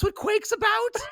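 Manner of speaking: high, slow disbelieving question; mock surprise. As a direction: act stunned at the lore. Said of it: "I think we were like".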